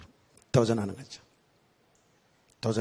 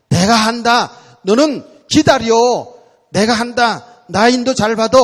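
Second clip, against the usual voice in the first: loud, firm, higher; voice of God; 5 semitones higher, 8 dB louder than usual. Retold or imitated. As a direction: speak as God reassuring his child. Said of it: "하나님 이렇게 말씀하고". (하나님이 자녀에게 기다리라고 단호하게 말함)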